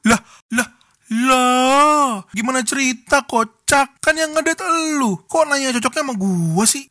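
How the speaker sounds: loud, high, baffled outburst; incredulous. Real, not acted. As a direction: blurt it out in disbelief. Incredulous reaction, raised.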